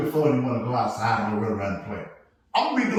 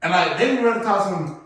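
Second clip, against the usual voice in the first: ordinary talk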